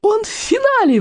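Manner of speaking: very high excited squeal; squealing girl. Acted. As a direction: squeal it out with delight. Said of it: "взвизгнула Камикадзе".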